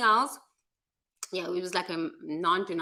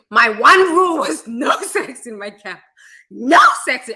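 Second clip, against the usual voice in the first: emphatic raised voice laying down the rule; loud rule; 5 semitones higher, 11 dB louder than usual. Retold or imitated. Camp leader stating his rule, loud and emphatic. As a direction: bark out the one rule loudly.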